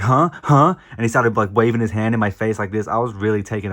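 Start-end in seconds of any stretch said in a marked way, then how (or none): 0.0-0.7 mock yelled huh. Retold angry hero in store.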